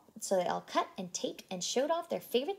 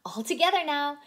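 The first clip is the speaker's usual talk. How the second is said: cheer; 6 semitones higher, 8 dB louder than usual. A narrator's reading. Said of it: "cheered Missus Pepper".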